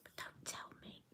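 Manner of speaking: hushed confiding whisper. Real, not acted. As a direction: whisper it like a secret. Confiding aside.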